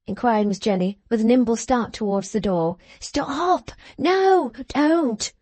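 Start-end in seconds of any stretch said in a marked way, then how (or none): 3.0-5.3 high, urgent protest; cried out in alarm. Acted. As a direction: stop her in a panic. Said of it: "cried Fledgeby".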